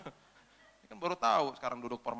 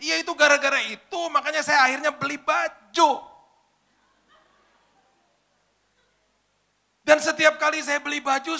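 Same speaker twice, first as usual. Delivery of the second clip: crying; 12 semitones higher, 8 dB louder than usual. Retold or imitated. Girl suddenly breaks down about her father, crying loudly. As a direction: sob out the grudge. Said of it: "Sambil nangis-nangis".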